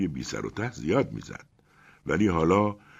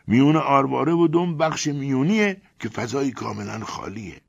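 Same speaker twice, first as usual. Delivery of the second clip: serious and impressive; 9 semitones higher, 3 dB louder than usual. A narrator's reading. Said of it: "با لحنی جدی و تأثیرگذار گفت".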